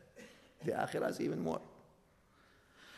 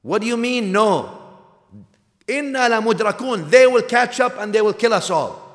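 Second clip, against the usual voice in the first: higher, louder panicked protest; 10 semitones higher, 9 dB louder than usual. Acted. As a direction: cry out as if the army is at your heels. Terrified followers protest.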